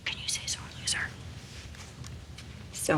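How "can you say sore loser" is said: soft whispered question. Acted. Father whispering to the baby, hushed question. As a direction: breathe it in a whisper.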